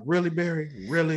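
high, incredulous questioning; mock exasperation. Retold bedtime complaint about Barry.

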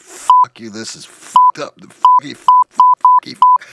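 screaming obscenities in a rage; enraged coworker losing it on a Zoom call, screaming; furious ranting. Retold or imitated. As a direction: blow a gasket, yelling curses. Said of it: "blows a gasket".

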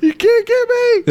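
high, slow teasing taunt. Pretend. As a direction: tease the chained dog. Taunting the chained dog.

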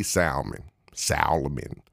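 SALMON said slowly with the L sounded; emphatic L. Retold or imitated. Showing how they say salmon, emphatic L in SALMON. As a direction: stretch SALMON and lean on the L. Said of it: "They put a lot of emphasis".

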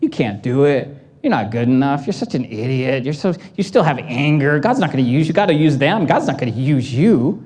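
low, sneering inner critic; nagging inner voice. Retold or imitated. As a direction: whisper doubt into your own ear. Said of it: "the voice that's on the inside of us".

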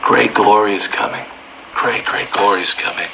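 ordinary delivery

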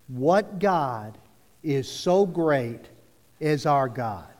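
measured phrases with short pauses; slow read-back. Shown as a demonstration. Preacher leading people reading it back, slow and measured. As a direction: lead a group recitation, slowly. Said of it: "read it back with me".

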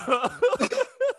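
loud, very high-pitched cackle; laughing hard. Real, not acted. Cracking up at the upstate New York joke, high laugh. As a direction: burst into real, shrieking laughter.

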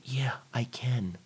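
unvoiced little whisper of agreement; whispered yes. Trainee answering the coach, whispered. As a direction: breathe it out meekly. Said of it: "And they say".

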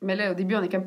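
ordinary delivery

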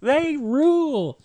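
high, whooping Southern yokel cheer; exaggerated Southern drawl, high and loud; dumb Southern accent. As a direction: holler it like a gung-ho Southern police fan. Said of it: "Sorry for doing the Southern thing".

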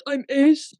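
normal talking voice

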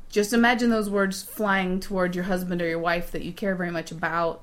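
ordinary talk, nothing marked

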